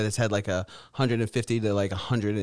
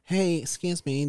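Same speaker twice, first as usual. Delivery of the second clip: higher, slow mock kid voice; mocking imitation; 5 semitones higher, slower than usual. Teasing the friend's imitation of kids in a chat, mocking put-on voice. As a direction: mimic an imagined polite child. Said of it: "Why are you imagining they say".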